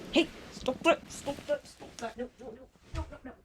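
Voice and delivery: urgent, fading scold; scolding the cat, starting high, fading to near whisper. Real, not acted. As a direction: stop the cat, then trail off quietly. Stopping cat from eating string.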